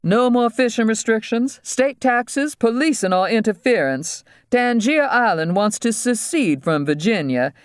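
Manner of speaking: high-pitched, urgent demands; demanding captive dentist. Acted. Voices the dentist.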